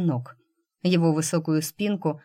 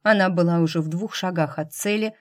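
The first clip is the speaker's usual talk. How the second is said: usual speaking voice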